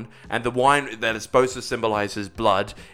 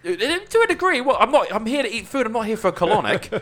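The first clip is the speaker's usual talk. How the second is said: indignant; 12 semitones higher, 4 dB louder than usual. Real, not acted. Indignant joking about vegan food.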